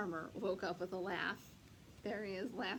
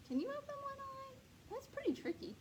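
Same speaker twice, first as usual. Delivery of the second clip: playful high voice. Acted. Reading to children, playful question.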